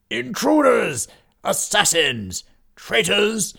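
high, loud cry of alarm; old knights raising the alarm with a shout. Acted. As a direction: bellow at the intruders. Old knights spot intruders.

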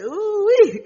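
high, loud delighted squeal; excited squeal. Retold or imitated. Reacting to being called little daddy, squealing high and loud. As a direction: shriek with delight.

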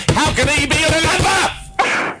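high, wailing disbelief; exasperated wail. Real, not acted. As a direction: cry out in despair. Exasperated at misheard letter.

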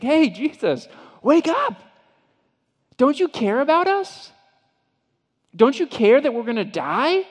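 high, loud, desperate yelling over the storm; panicked shout. Acted. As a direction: shout him awake in terror. Disciple wakes sleeping Jesus in storm.

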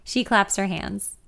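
usual speaking voice

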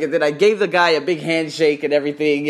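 ordinary talk

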